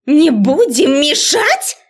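высокий, растянутый возмущённый крик; переспроси гневно, перекрикивая гам; яростный окрик. A narrator's reading. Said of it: "гаркнула разозлённая до предела профессор". (разъярённая преподавательница, громко и протяжно)

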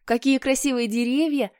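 put-on eager boy. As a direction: marvel like a delighted little brother. Peter gushing about trees.

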